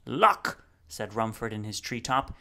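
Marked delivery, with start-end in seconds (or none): 0.1-0.6 booming proclamation. Acted. Rumford booms one word.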